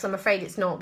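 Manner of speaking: ordinary delivery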